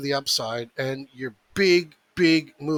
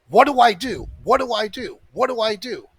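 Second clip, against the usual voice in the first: mocking panicked viewers; 6 semitones higher, 6 dB louder than usual.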